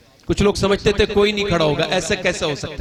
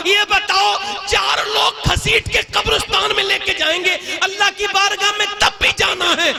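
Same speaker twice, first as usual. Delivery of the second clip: high, shouted sermon climax; full-force shouted sermon; fiery preaching; 10 semitones higher than usual. Real, not acted. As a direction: preach it out to the crowd.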